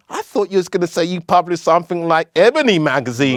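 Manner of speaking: mocking girl. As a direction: tease him like the girl at the bar, up high. Girls mock his answer.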